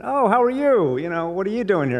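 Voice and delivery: put-on high, chatty Israeli accent, lightly done. Watch shop woman greeting a customer in a light Israeli accent. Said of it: "I shouldn't do the Israeli accent".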